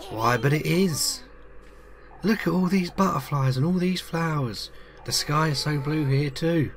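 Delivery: put-on high girlish Freya voice, full of wonder; Freya's voice. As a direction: lift into a high, wide-eyed girl. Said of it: "I can never get her, a voice for her proper".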